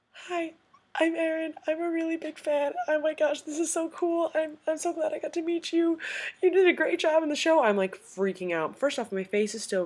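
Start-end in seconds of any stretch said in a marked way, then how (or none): 0.1-7.6 high, squeaky fangirl greeting; retold awestruck hello, pitched way up; starstruck fan. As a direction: gush nervously in a high little voice. Starstruck fan meeting her idol.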